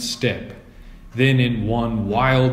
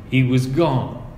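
usual speaking voice

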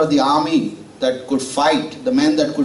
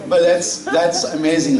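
ordinary delivery

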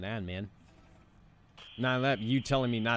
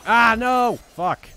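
sudden high shriek; startled scream; 15 dB louder, much slower than usual. Real, not acted. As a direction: scream in shock. Surprised by enemy.

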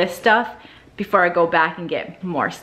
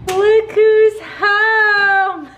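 welcoming someone home; 11 semitones higher, 5 dB louder, much slower than usual. Real, not acted. Dog arrives home.

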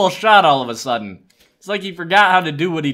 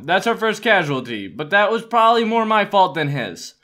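normal talking voice

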